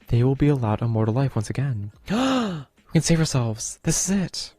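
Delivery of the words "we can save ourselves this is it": 1 excited gasp. Acted. Discovers a way to be saved.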